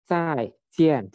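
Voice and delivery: "Zaijian" high and thrust down on each syllable; stamped fourth tone. Shown as a demonstration. Teacher shows the forceful fourth tone, stamped "Zaijian". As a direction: punch both halves of "Zaijian", falling from high. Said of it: "Jadi dihentakan".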